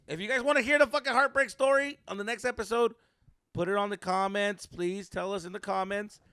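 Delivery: excited outro. Real, not acted. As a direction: pump up the audience. Outro call to viewers.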